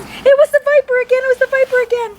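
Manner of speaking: put-on high, loud panicked child voice; terrified girl. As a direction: shriek it to your brother. Terrified sister tells brother.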